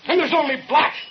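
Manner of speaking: building accusation. Acted. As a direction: drive each phrase up until the blackout.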